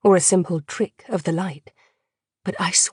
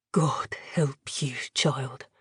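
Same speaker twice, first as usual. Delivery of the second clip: a soft, breathy, grieving whisper; a pitying whisper to the captive girl; sorrowful whisper; 5 semitones lower, 8 dB quieter, slower than usual. A narrator's reading. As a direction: sigh the words out in pity. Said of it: "a sigh then, thick with sorrow".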